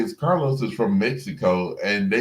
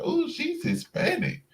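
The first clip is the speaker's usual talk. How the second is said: high, softish ooh of realization; mock discovery; 4 dB quieter than usual. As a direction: act out a sudden realization, pitched way up. Retelling her first impression.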